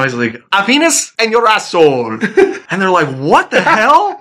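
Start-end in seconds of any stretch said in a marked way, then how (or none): 0.5-2.2 high mock-French, H dropped from "Happiness"; comic French-accented punchline. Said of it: "Mounties that speak French". 3.2-4.2 high, stretched-out mock disbelief; incredulous, pitch shooting up; baffled outburst. Acting out the baffled listeners' reply.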